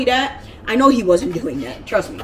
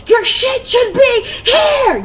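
very high, loud, slow, cracking; full scream; 8 dB louder, much slower than usual. Retold or imitated. Screaming at her brother about the move, voice breaking. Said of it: "I lost my voice that day".

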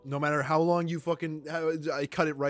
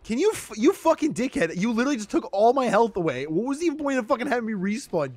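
higher, louder complaint; exasperated rant; 5 semitones higher, 5 dB louder than usual. Real, not acted. Ranting at Anakin about respawning, exasperated. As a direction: rant at him in frustration.

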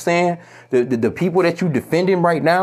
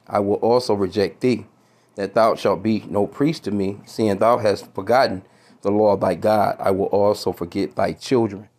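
scripture reading; 6 semitones lower than usual. Acted. Reading Hosea to his viewers, measured and grave. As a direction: read the verse like a preacher. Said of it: "Hosea four and six".

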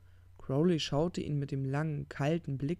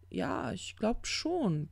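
slow, higher, drawn-out concession; unsure, stretched-out yes; hesitant agreement; 6 semitones higher, much slower than usual. Acted. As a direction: draw it out doubtfully. Hesitant confirmation after a pause.

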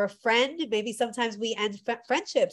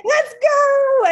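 high, loud, excited shout; excited call; 14 semitones higher, 10 dB louder than usual. Retold or imitated. Leading her friends into the ocean, high excited cry. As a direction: call out to lead the way.

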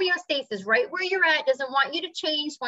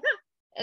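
put-on child's relieved ah; 12 semitones higher than usual. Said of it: "they're like".

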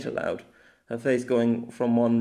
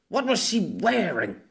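slow, higher, emphatic question; straining to remember; 8 semitones higher than usual. Acted. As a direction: draw the question out in frustration.

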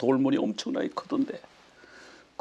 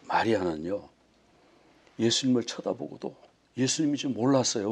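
ordinary talk

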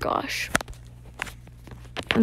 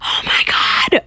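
loud, breath-filled exclamation; awestruck outburst; 6 dB louder, slower than usual. Real, not acted. Gamer looking at the new map, awestruck outburst. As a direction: gasp the words out loudly.

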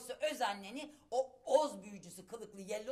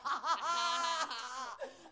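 regal laugh; 4 semitones higher than usual. Acted. Possessed mother laughing.

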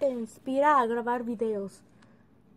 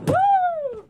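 excited cheer; 8 dB louder than usual. Real, not acted.

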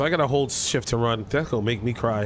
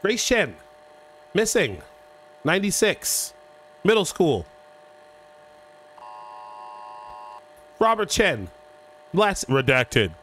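reading titles aloud; slower than usual. Shown as a demonstration. Reading intro text aloud.

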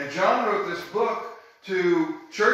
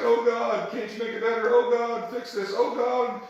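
higher, pleading "Oh God" parody; mock whiny prayer; 6 semitones higher than usual. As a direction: mimic a helpless complainer begging God. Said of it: "we just can't sit around and say".

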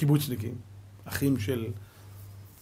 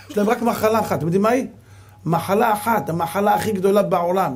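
raised, higher preaching voice to the crowd; fiery preaching; 5 semitones higher, 5 dB louder than usual. Acted. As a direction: build the sermon up with fervour. (דרשה נלהבת בקול מורם)

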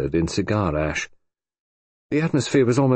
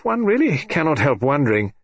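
Sir George; 6 semitones higher than usual. A narrator's reading.

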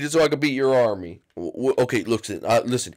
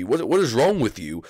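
usual speaking voice

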